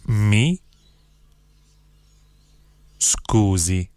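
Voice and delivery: each word set apart by long pauses; one word, pause, next word; slow, word by word. Shown as a demonstration. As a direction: spell it out slowly for learners to repeat. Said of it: "then I'll say one word at a time slowly".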